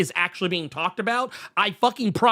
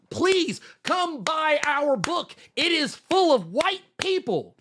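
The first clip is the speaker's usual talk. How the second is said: mock publisher pitch; 8 semitones higher, slower than usual. Mocking the publisher's message.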